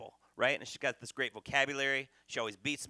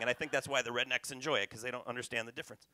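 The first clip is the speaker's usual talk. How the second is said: usual speaking voice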